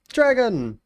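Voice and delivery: normal talking voice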